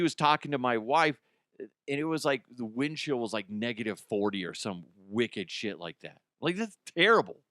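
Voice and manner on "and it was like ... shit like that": normal talking voice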